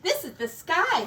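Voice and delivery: ordinary delivery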